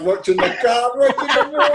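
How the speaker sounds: cracking up mid-phrase; laughing punchline. Real, not acted. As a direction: pitch climbing as laughter takes over.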